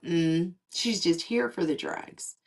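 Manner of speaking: soft, slow, sneering murmur; dismissive nurse. Retold or imitated. Nurse dismissing a patient as a drug seeker, low and sneering. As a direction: mutter it like a judging nurse. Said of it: "and go".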